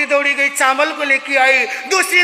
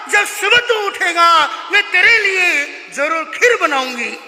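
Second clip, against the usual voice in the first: usual speaking voice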